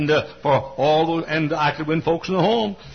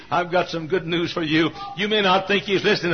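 ordinary talk

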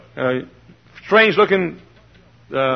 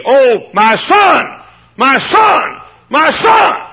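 slow opening, climbing higher and louder to an anguished shout; pleading cry; 10 semitones higher, 6 dB louder, much slower than usual. Acted. Preacher pleading for the congregation's children, rising to a cry. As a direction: beg like a father losing his child. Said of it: "I'm gonna stand up and plead".

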